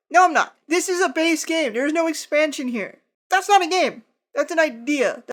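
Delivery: loud, high, heated rant; loud angry rant. Real, not acted. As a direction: rant it loudly. Ranting about rules in expansion.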